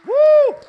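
loud, high joyful whoop; a sudden ecstatic shout of praise. Real, not acted. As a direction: let out a big church whoop.

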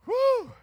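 high, loud whew; overwhelmed whew. Retold or imitated. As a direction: blow out an amazed whew. Recalling being overwhelmed.

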